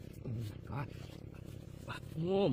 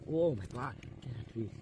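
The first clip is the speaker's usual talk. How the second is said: ordinary delivery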